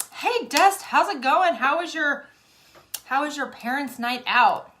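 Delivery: high, loud cheerful hello; excited greeting. Real, not acted. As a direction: greet a friend with a bright burst. Calling out greeting to friend.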